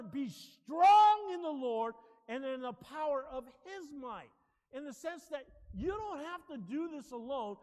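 loud, slow, emphatic sermon delivery; forceful preaching. Real, not acted. Preacher urging the church to walk in power, forceful. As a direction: preach it with force, leaning on key words.

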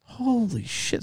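high, hushed amazement; awestruck. Retold or imitated. Boyfriend hearing the young fan's success story, awestruck. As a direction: let it out softly, high and amazed.

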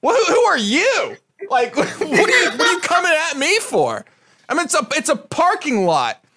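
high-pitched, incredulous protest; retold indignant comeback. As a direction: throw the challenge back, voice climbing. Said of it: "And I'm like".

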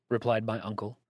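ordinary delivery, nothing marked

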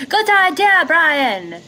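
high-pitched acted yell; yelling back. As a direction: call back over the wind. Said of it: "I'm gonna yell back".

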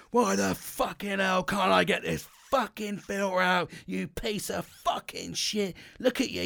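high, slow, raging at the stuck filter; seething at a wedged filter, pitched high; retold angry rant. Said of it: "I was going".

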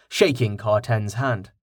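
ordinary delivery, nothing marked

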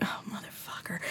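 breathless whispered curse. Retold or imitated. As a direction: mutter it under your breath in dread. Inner reaction to the creepy compliment.

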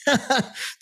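a short high laugh at the absurdity; disbelieving laugh. Real, not acted.

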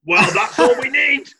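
high, loud, laughing. Real, not acted. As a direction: cry it out through a laugh. Laughing outburst at the idea.

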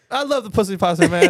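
high, loud fan on the street; playful Brooklyn fan voice; mock street interview. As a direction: gush like a passerby. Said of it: "Let's ask the street".

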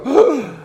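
sharp high mock gasp of shock. Preacher mocks a naive believer, a sharp gasp. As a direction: gasp as if caught off guard by trouble.

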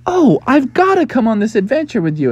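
high-pitched, excited plea; eager excitement. Acted. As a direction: beg eagerly to come along. Frog begs to join.

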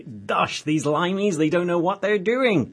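put-on cod American accent. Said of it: "cod accent there".

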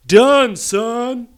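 loud, high, drawn-out punchline; big mock yell; mock triumphant shout. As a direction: holler it like a hammed-up boast.

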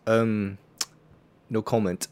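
understated dodging director; Christopher Nolan. Acted. Co-host playing a director questioned about outbreaks, lightly voiced reluctance. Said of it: "that's a great Christopher Nolan impression".

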